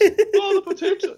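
very high, loud fake-Irish wail; mock Irish accent. Farmer wailing for the potato, loud mock Irish accent. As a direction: cry out in a hammy Irish accent.

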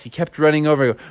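ordinary delivery, nothing marked

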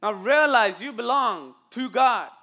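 loud closing charge; forceful preaching. Real, not acted. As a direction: exhort the church, landing hard on the last words.

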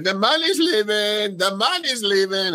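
high, drawn-out announcement; put-on high wail, words stretched; mocking customer imitation. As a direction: mimic a drunk customer proclaiming it on the way out. Literal imitation of customer's parting cry.

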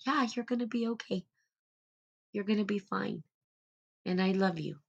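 put-on mother soothing her child; gentle reassurance. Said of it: "It's like".

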